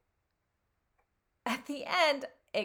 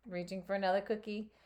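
usual speaking voice

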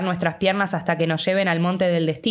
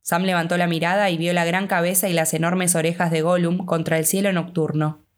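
plain reading voice

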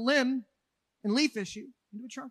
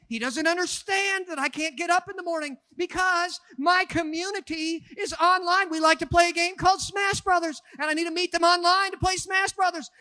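put-on whiny young man; 7 semitones higher, 6 dB louder than usual. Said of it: "he said".

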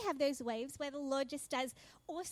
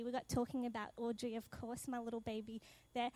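normal talking voice

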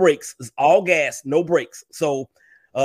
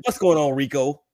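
ordinary delivery

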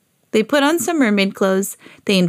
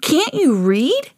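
slow grumpy sister voice; grumpy big sister; 8 semitones higher, slower than usual. A narrator's reading. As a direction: drag it out like a cranky sister. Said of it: "said my sister".

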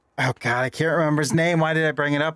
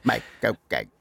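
softer mock Cockney line; Michael Caine impression; 3 semitones lower, 4 dB quieter than usual. Shown as a demonstration. Co-host echoing the bit, soft Caine accent. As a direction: murmur it like Caine. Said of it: "do a Michael Caine impression".

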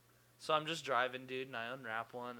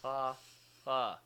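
robot laugh; 2 semitones higher, 4 dB louder than usual. Pretend. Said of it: "I am a robot".

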